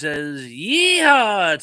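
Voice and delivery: high, loud cowboy whoop; yeehaw holler. Pretend. Hollers a chatter's typed cheer.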